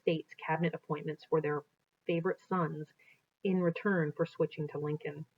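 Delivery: reading aloud from a history book, steady and clear; storybook narration. Acted. A teacher reading a Lincoln history aloud to students, in narration voice.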